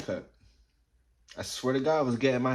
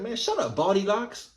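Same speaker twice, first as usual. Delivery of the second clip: put-on high taunting girl's voice; mock him like a schoolyard bully; 8 semitones higher than usual. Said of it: "Straight up call me baldy locks".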